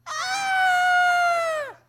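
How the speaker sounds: long, high-pitched yell; imitated drawn-out howl of pain; brother's yell. Brother being spanked behind a thin wall, yelling. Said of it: "I'm hearing my brother just yell".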